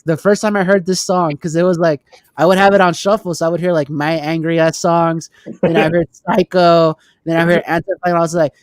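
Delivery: ordinary talk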